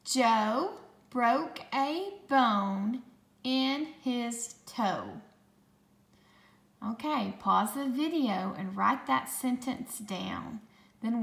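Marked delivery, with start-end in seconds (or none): 0.0-5.2 slow, word-by-word dictation; slow, clear dictation voice; dictation pace. Shown as a demonstration. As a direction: read it out slowly for spelling. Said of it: "Why don't you say it with me?".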